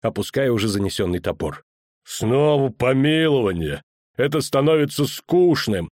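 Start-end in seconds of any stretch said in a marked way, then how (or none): 2.0-5.9 drawn-out, mildly fed-up complaint; grumbling. A narrator's reading. Executioner hearing of yet another pardon, grumbling. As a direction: complain with a touch of weary annoyance. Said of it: "проворчал палач".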